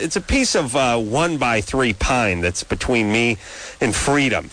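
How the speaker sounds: normal talking voice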